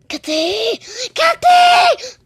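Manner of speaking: very high, very loud squeal building up; squealed calling out; excited shout. Real, not acted. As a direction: shriek the name with joy.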